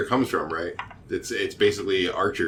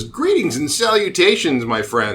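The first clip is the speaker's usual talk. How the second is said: higher-pitched, florid greeting; effusive young stranger; 6 semitones higher, 4 dB louder than usual. Acted. Stranger greeting adventurers in the bar, effusive. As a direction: greet like an over-friendly stranger. Voices the striking young man.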